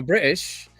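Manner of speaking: "British" with a catch in the throat for the T; glottal-stop British. Shown as a demonstration. Telling the listener they're British now, glottal-stop T. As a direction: swallow the middle T of "British". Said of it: "put a glottal stop on that T".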